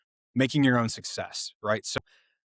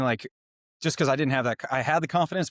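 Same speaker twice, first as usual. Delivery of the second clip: ordinary talk, nothing marked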